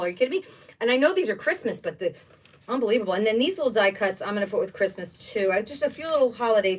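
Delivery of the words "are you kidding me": normal talking voice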